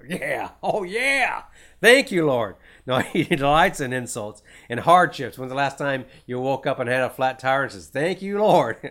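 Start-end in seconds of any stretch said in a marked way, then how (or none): 0.1-2.6 slow, high, sarcastic yeah, thank you Lord; mock thankfulness. Mocking a believer thanking God for being insulted. 7.9-8.8 mock thankfulness. Mock thanks for flat tire.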